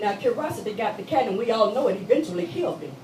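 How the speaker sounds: usual speaking voice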